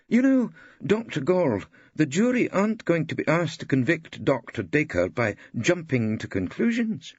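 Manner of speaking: the judge sets the witness straight; stern old judge. A narrator's reading. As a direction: judge scolds witness, firm.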